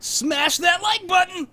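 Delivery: slow, high shouted youtuber outro; mock youtuber. Host parodying a YouTuber outro, shouting it slowly and high. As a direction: ham up the influencer catchphrase.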